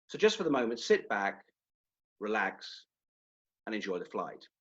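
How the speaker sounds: credible airline captain, dropping down at each phrase end; measured, unhurried cockpit announcement; pilot announcement. Shown as a demonstration. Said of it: "here's what the pilot is likely to say".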